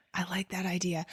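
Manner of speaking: sudden hushed whisper, no voice. Real, not acted. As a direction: breathe it out in an awed whisper. Reacting quietly to spooky idea.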